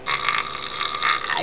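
unpitched scream held in the mouth; rasped "ah" scream, kept back in the mouth; head scream. Shown as a demonstration. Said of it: "it's in my mouth".

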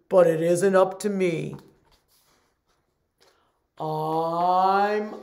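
loud glum pouty fish; gurgling fish man croak. Acted. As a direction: sulk it out as the fish. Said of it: "says the fish".

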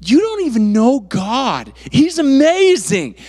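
high, slow, emphatic exclamation; fervent retort. Retold or imitated. Young believer answering the tempting world, fervent high retort. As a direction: draw it out with high-pitched zeal.

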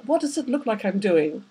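plain reading voice

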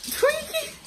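high sing-song call to the pet; calling the dog. Real, not acted. Calling the dog mid-sentence.

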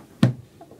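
breathy silent wheeze laugh; weird wheezy laugh. Real, not acted. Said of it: "I don't laugh like that".

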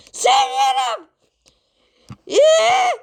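a piercing high yell of a little singer; loud high greeting. Acted. Pumpkin yells his greeting into the judge's ear, loud and high. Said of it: "başım şişti ya".

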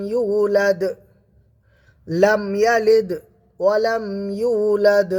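slow rising recitation; Quran recitation. Shown as a demonstration. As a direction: recite it slowly and lift the voice.

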